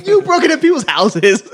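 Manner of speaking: high, loud laughing retort; calling out a friend's hypocrisy while laughing. Real, not acted.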